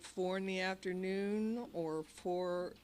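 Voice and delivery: usual speaking voice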